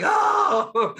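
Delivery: high, loud startled "Oh!"; stunned yelp. Retold or imitated. Fooled kid reacting to the magician's reveal, loud high shout. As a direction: jolt out a shocked cry.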